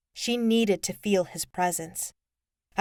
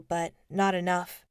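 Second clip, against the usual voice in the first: usual speaking voice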